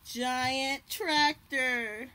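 slow, booming book title; dramatic heading. Acted. Reading a book heading to children, drawn out and dramatic. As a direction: stretch it out big and grand for the kids.

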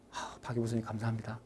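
soft, low grateful aside; inner thanks. Retold or imitated. Student silently thanking professor Park, low and soft. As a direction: murmur thanks inwardly. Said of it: "그럼 속으로 어떻게".

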